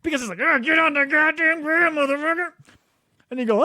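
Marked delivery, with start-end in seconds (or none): 0.4-2.5 high, strained yell imitating an arresting officer; mock cop shout. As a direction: mimic a dude shouting orders. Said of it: "you got a dude shouting at you".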